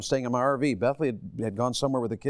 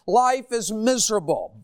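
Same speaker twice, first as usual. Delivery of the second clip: a hot, short-tempered outburst; angry frustrated rant; 7 semitones higher than usual. Acted. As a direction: preach the bitterness out. Preaching the angry life.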